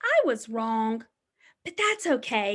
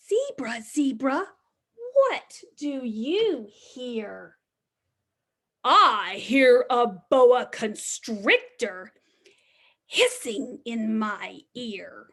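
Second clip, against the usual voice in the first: slow, sing-song picture book reading; reading to children; 3 semitones lower, slower than usual. Acted. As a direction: read the refrain slowly to little ones. Reads the rhyming book refrain.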